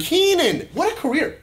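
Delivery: very high, loud fan enthusiasm; excited praise. Real, not acted. Fan marveling at an actor's career, excited praise. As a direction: cheer the name, then marvel loudly.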